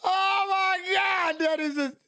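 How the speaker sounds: high-pitched helpless laughter through the words; can barely speak for laughing; real tears-of-laughter squeal; crying laughing. Real, not acted. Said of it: "I'm crying. I got tears in my eyes".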